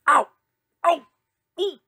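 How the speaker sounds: little yelps of pain; pained cries. A narrator's reading. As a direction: cry out as if knocked over. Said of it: "cried the three brothers".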